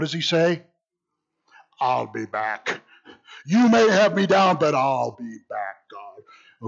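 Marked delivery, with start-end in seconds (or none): 1.8-2.8 low, slow robotic Terminator line. Retold or imitated. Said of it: "famous quote from the Terminator movie". 3.4-6.7 a hint of the Terminator, defiant; Terminator Jonah. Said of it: "famous quote from the Terminator movie".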